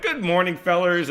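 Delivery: chipper and happy. Retold or imitated. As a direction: sing out a bright morning hello. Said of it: "I was, like, chipper and happy".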